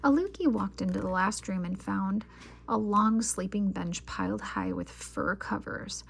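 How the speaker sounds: usual speaking voice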